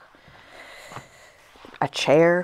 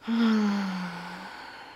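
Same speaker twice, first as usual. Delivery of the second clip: long, voiced sigh of release; 2 semitones higher than usual. Real, not acted. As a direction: let out a long breath into the stretch. Breathing out during yoga stretch.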